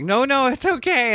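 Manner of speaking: reassuring. Retold or imitated. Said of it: "they were like".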